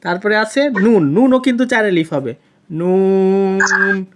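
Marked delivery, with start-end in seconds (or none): 2.7-4.0 নুন held very long and slow; lengthened nun. Shown as a demonstration. Teacher showing a student how long নুন is held, stretched. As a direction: stretch নুন four counts. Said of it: "এভাবে পড়তে হবে".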